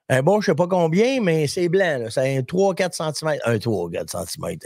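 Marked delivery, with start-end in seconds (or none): 3.5-4.6 much lower and softer, mock radio voice; deep, hushed joking repeat. Shown as a demonstration. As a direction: drop it way down for fun. Repeats it in a put-on voice.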